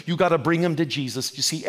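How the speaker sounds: usual speaking voice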